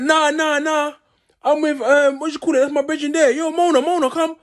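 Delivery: high-pitched mocking impression of a friend protesting to police; mocking Clamp. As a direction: squeak it out like a nervous mate calling his friend over. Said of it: "listen to him".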